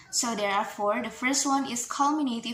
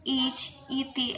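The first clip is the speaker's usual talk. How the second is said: ordinary talk, nothing marked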